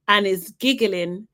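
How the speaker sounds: ordinary delivery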